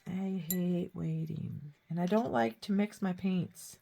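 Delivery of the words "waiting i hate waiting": slow, breathy whispered complaint; whining whisper. Retold or imitated. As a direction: whisper it slowly like an impatient kid. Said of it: "I'm like".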